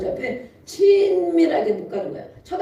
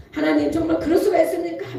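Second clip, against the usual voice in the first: higher, laughing mock complaint; arguing with God; 6 semitones higher than usual. Pastor showing a complaining prayer, higher and laughing. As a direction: protest to God with a chuckle. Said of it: "막 이럴 때가 있거든요".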